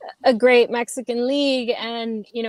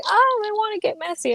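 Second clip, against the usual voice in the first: high, bright-eyed "oh" of discovery; a touch of mock excitement; mock excited thought; 10 semitones higher than usual. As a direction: play the sudden eager realization, lightly. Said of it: "and I was like".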